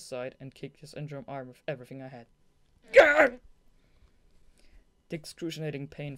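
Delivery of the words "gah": loud, high pained yelp; pained cry. Acted. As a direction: cry out as if kicked. Voicing the kick in the story.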